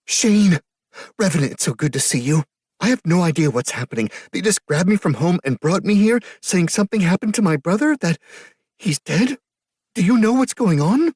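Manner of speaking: put-on distressed young woman. A narrator's reading. Grieving sister begging Shane for answers, high and frantic. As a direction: rush it out, panicked and close to tears. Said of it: "familiar, distressed voice".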